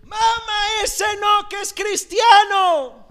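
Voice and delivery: high, loud yell across the street; retold shout. Daughter yells to her mother on a Sevilla street, a high shout. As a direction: scream a warning to mother. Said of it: "le pega un grito que se escuchó en toda la calle".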